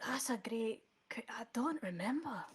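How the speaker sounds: faint unvoiced whisper from the dead; a ghostly whisper barely there. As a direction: breathe the words with no voice. Dead professor answers the spell.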